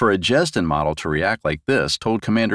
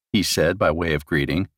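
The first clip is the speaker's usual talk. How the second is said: ordinary delivery, nothing marked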